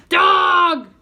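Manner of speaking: high, very loud parrot squawk; a parrot shrieking at a dog walking in; parrot scream. A narrator's reading. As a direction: screech the word at the dog. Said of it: "screamed Gertrude".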